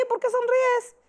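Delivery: mock-menacing inmate; tough inmate challenge. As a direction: confront the new girl.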